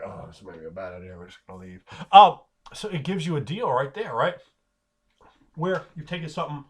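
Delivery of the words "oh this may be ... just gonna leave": low, very soft retreating voice; uneasy backing off. Acted. Orcs deciding to leave, uneasy backing off. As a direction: trail off quietly as you back away.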